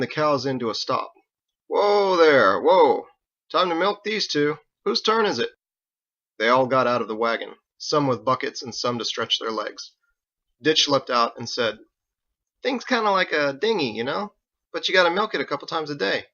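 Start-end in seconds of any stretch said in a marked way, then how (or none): 1.7-3.1 loud, drawn-out call to the cows; louder call of whoa, falling. Acted. Driver calling cows to stop. 3.5-5.5 higher, folksy driver's voice; a cheerful wagon driver asking around; higher farmhand voice; lightly voiced teamster. Wells, a voiced figure. 12.6-16.3 put-on higher, casual slangy young man; a streetwise kid explaining; higher easygoing voice; lightly voiced Ditch. Ditch's voice, pitched up.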